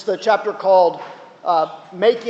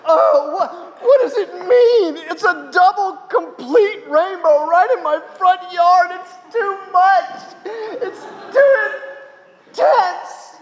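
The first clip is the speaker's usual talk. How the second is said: mock sobbing awe; 11 semitones higher, slower than usual. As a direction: wail it like joy too big to hold. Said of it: "he breaks down crying, he says".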